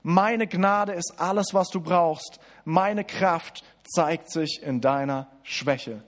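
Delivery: slowly, weighing each word of grace; langsam betont, Worte Jesu. Acted. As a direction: let the promise land slowly. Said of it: "da sagt Jesus". (Jesu Worte langsam und feierlich)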